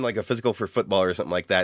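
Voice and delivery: ordinary delivery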